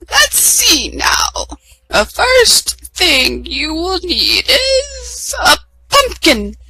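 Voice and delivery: high, slow, sing-song fairy voice; fairy godmother. A narrator's reading. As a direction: announce yourself with magic. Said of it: "the fairy godmother said".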